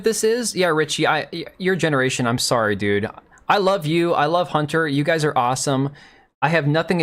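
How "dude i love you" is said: usual speaking voice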